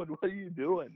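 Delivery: high, laughing through the question; mocking disbelief with a laugh. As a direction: repeat it while cracking up. Teammates staring in disbelief.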